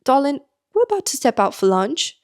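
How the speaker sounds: attempted British accent. Staff offering to fetch lunch, imitated British accent. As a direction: put on a polite British accent. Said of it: "if I can do the accent".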